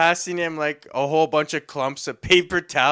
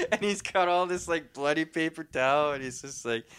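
normal talking voice

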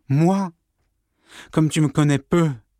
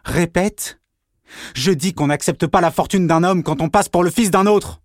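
high, loud contemptuous accusation; raised voice, bitter scorn; angry quarrel; 7 semitones higher, 3 dB louder than usual. Acted. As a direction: spit the insult at your brother. Brothers quarrel, Pierre accuses Jean.

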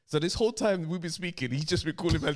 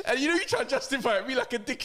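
usual speaking voice

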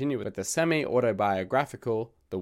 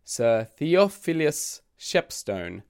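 slow and careful over Theophilus Shepstone; deliberately savoring a long name; careful enunciation; 5 semitones higher, much slower than usual. Shown as a demonstration. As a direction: spell the mouthful of a name out slowly. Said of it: "here's a mouthful of a name".

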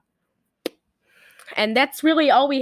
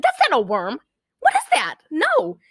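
retold disbelief; 7 semitones higher than usual. Retold first reaction to fake worm.

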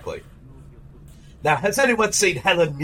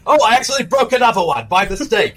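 put-on Malcolm McDowell British accent; 6 semitones higher, 4 dB louder than usual. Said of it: "my British accent".

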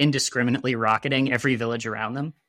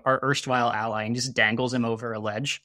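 usual speaking voice

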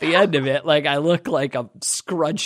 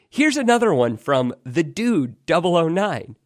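usual speaking voice

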